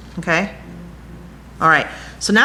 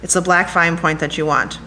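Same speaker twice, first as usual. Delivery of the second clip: ordinary talk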